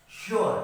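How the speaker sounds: श्योर said as "shor", high and loud; teacher modelling a word for students; model word sure. Shown as a demonstration. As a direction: stress the yo sound in श्योर. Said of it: "जैसे".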